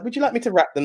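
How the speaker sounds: a high, sugary polite housewife voice; high polite wife. Retold or imitated. Wife politely offering to wrap the eggs, high and sweet. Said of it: "she's like".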